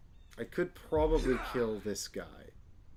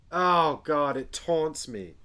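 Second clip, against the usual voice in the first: upset; 4 semitones higher, 8 dB louder, slower than usual. Real, not acted. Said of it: "so upset".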